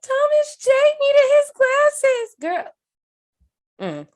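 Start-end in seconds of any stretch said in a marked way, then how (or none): 0.0-2.7 high, slow, wailing cry-voice; mock sobbing. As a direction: wail it out like a kid bawling at a movie. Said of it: "I'm like".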